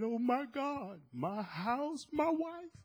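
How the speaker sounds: high, strained cry of loss; breaking down. Retold or imitated. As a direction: voice cracking as he sees the house gone. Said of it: "he burst out in tears".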